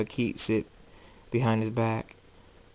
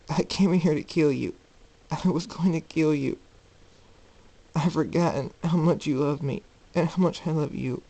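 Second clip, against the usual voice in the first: higher-pitched, pleading remorse; tearful apology; 5 semitones higher than usual. A narrator's reading. Son confessing and apologizing to his mother, tearful. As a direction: plead for forgiveness, voice lifted as if near tears. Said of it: "He cries into her shoulder".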